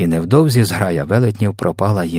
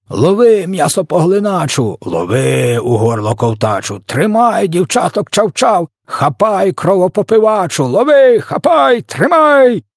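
high, rising gleeful hollering; excited shouting; 10 semitones higher, 4 dB louder, slower than usual. A narrator's reading. Giants toss their victim to one another, shouting wildly. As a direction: call out ever higher, ending in loud drawn cries.

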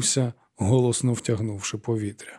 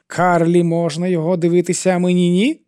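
indignant question; 7 semitones higher, 4 dB louder than usual. A narrator's reading. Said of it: "спитав він".

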